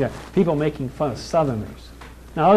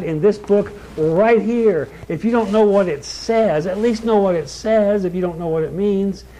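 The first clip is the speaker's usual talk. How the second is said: raised, insistent pulpit delivery; forceful preaching; 4 semitones higher, 4 dB louder than usual. Real, not acted. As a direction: warn the congregation in a higher, driving preacher's voice.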